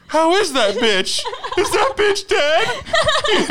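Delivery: high, loud, laughing mock concern. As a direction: fake worry that cracks into laughter. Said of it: "Not laugh and be like".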